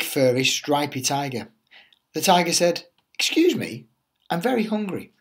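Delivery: lively storybook read-aloud voice; animated teacher reading The Tiger That Came to Tea; reading to children. Acted. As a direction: tell it like a bedtime picture book for little ones. Teacher reads picture book aloud.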